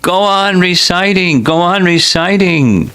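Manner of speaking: high, insistent repeated cry; loud exclamation. A narrator's reading. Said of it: "the Lord loudly exclaimed".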